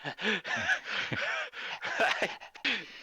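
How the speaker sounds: high, breathy animal pants; heavy panting. Acted. Furred character overheating in the sun, panting heavily. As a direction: pant hard like an overheated furred creature. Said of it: "You're panting heavily".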